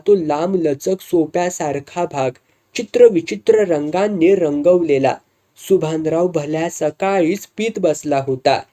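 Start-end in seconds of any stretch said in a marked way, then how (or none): none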